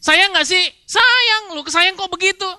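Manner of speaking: a spouse pleading to be loved, high and whining. Acted. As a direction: beg a spouse to prove their love. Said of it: "Terus kita bilang".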